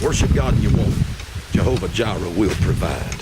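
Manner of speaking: loud preacher's declaration; preaching. Acted. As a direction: proclaim it to the congregation. Preaching at full force.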